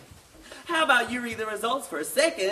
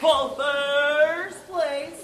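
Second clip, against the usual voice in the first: very slow, higher announcer call; drawn-out announcement; 4 semitones higher, 4 dB louder, much slower than usual. Acted. Announcer revealing first place, long drawn-out call. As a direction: stretch every word to build suspense. Announcer draws out the big result.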